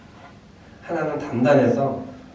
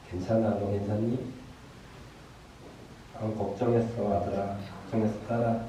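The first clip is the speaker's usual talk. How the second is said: God's tender reassurance; 10 dB quieter than usual. Acted. God comforting a worried-over son and daughter, soft and tender. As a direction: comfort them gently, pausing between phrases. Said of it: "여러분들이 들어야 될 음성은".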